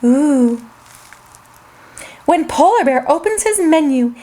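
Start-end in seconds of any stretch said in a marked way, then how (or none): none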